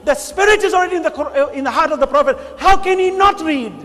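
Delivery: loud, driving sermon delivery; fiery preaching. Acted. Preacher rails against the stories to his congregation, loud and fiery. Preacher raises voice, full force.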